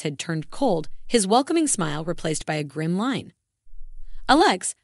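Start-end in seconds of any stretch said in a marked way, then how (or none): 4.3-4.7 called out, high and louder; wife calling husband to greet her mother, calling out. Acted. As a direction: call his name across the room. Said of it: "I called out".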